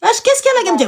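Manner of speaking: sharply high disbelieving what did she win; mock outrage at the friend's claim; incredulous. Mocking disbelief.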